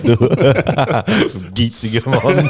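slow, drawn-out laughing tease. Real, not acted. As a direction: laugh the words out slowly. Teasing friend over the cheap gift.

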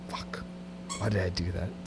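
usual speaking voice

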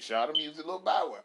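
usual speaking voice

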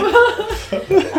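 loud bright laugh. Real, not acted. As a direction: burst out laughing. Laughing at hug joke.

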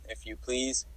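a real little crack in the voice. Real, not acted. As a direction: voice cracks on the last words. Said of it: "my voice just cracked a little".

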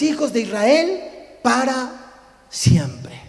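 loud, solemn proclamation from the pulpit; Scripture reading. Acted. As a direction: read it out forcefully, weighting each phrase. Reads Scripture aloud.

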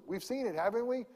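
usual speaking voice

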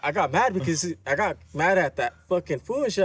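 usual speaking voice

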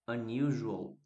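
"Unusual" as "ɐniʒuow", stressed at the start; English word modelled. Shown as a demonstration. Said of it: "unusual é diferente de unusual".

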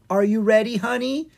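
high, eager question; excited. Acted. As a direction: ask like you can't wait to go. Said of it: "asked excitedly".

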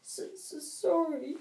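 blubbering, stammering apology; sobbing. Acted. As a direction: sob it out like a giant. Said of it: "sobbed Hagrid".

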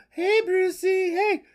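high, drawn-out flirty greeting; flirty women. Acted. Women greeting billionaire Bruce, high and flirty. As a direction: coo it high like admiring women. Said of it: "all the women are".

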